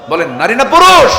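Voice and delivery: very loud, high call to the crowd; booming congregation prompt; rousing shout. Real, not acted. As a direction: shout the question to demand an answer.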